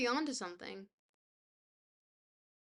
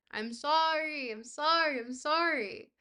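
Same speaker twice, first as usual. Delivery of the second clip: dramatic apology; 6 semitones higher, 7 dB louder, slower than usual. Real, not acted. Apologizing after fumbling the word.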